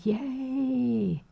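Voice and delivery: high, happy squeal of joy; excited cheer. Real, not acted. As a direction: cheer the news. Cheering a viewer's subscription.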